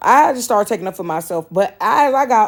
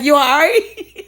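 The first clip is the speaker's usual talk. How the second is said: slow, laughing question; mock concern under the stall, cracking up; 8 semitones higher, 5 dB louder, slower than usual. Dad asking man in stall, laughing.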